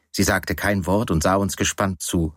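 ordinary delivery

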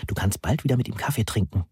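plain reading voice

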